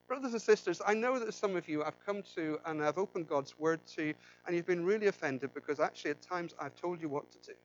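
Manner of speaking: ordinary talk, nothing marked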